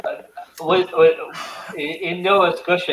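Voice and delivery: usual speaking voice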